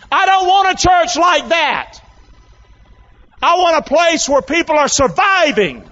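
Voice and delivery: high-pitched, insistent preacher; impassioned preaching. Real, not acted. Preacher wanting a church of survivors, impassioned.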